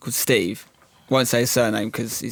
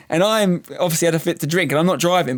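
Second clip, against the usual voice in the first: ordinary talk, nothing marked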